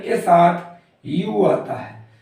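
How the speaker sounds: usual speaking voice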